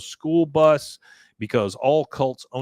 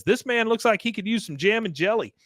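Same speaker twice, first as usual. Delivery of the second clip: high Peggy voice; 9 semitones higher than usual. Retold or imitated. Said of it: "and Peggy says".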